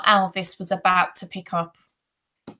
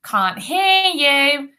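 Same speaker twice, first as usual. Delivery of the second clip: high, slow sing-out taunt; mocking bully feigning deafness; 6 semitones higher, 4 dB louder, much slower than usual. A narrator's reading. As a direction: tease with stretched-out words. Bully taunts struggling boy.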